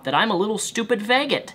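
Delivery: usual speaking voice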